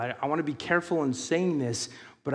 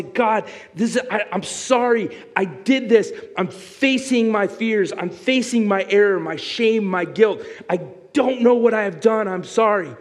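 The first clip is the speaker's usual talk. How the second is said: raised, loud fervent confession to God; humble confession; 7 semitones higher, 6 dB louder than usual. Pretend. Fallen man confesses his shame to God, raised and pleading. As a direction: pour out a heartfelt admission of guilt.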